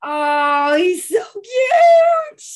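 high, slow squeal of delight, almost crying; squealing gush. Real, not acted. Friend gushing over a crush's photo, shrieking high. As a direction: squeal it out like the cutest thing ever.

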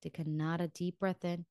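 guided meditation. Acted. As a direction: ease them gently into stillness. Leads breathing in guided meditation.

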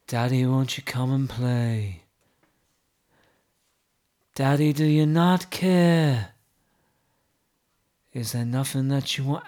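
low, slow, solemn reading of song lyrics; mock poetry recital. Host reciting pop lyrics to co-host, mock solemn. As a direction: recite it like deep poetry, deadpan.

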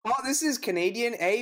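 a bad Canadian accent; Canadian guy voice. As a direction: play the Canadian with an eh. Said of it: "So this is my Canadian guy".

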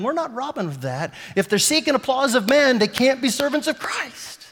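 fervent rebuke. Retold or imitated. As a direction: urge the crowd with conviction. Rebuking the crowd, preaching.

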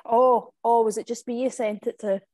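ordinary talk

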